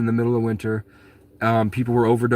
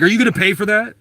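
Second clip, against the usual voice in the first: much higher and louder, imitating the manager's demand; mocking accusation; 10 semitones higher, 5 dB louder, faster than usual. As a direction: imitate her loud, high challenge. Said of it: "She's like".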